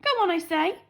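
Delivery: high, loud royal command. A narrator's reading. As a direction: command it impatiently. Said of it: "Princess Lolly commanded".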